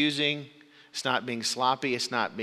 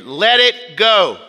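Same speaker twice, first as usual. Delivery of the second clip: loud, slow, raised-pitch insistence; firm command; 8 semitones higher, 15 dB louder, much slower than usual. Acted. College RA answers yelling parents about the stuck boy, firm command. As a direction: drive home each word slowly and forcefully.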